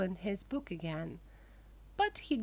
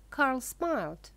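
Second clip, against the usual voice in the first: ordinary delivery, nothing marked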